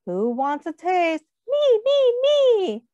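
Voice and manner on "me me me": put-on high, loud begging child; little kids clamouring; eager kids. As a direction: beg like a kid wanting a taste.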